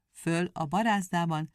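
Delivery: usual speaking voice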